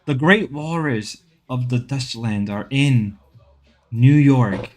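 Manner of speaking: excited wildlife narrator; nature documentary. Pretend.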